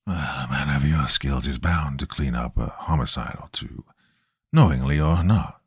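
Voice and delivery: raspy chain-smoker voice. A narrator's reading. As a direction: rasp it out like a lifelong smoker. Stranger's raspy voice.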